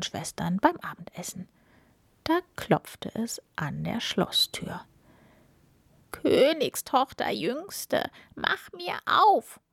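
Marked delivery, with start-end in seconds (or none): 6.1-9.6 slow, high, drawn-out frog call. A narrator's reading. Frog calling at the castle door, calling out. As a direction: stretch each word in a high call. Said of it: "rief eine Stimme".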